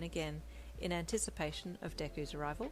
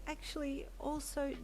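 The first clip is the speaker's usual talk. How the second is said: put-on worried young wife; 8 semitones higher than usual. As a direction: gently ask after her missing husband. Wife's voice in the story.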